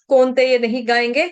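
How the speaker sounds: ordinary talk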